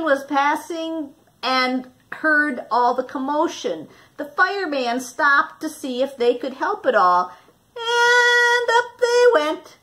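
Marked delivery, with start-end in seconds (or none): none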